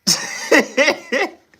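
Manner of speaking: loud, shrill-high cackling laugh; high burst of laughter. Real, not acted. Laughing hard at what the player said. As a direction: crack up out loud.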